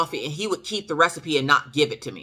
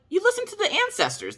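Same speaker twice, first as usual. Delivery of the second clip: high fast answer; faster than usual. Retold or imitated. Fast and high, answering the puzzled husband.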